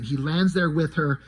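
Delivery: ordinary delivery, nothing marked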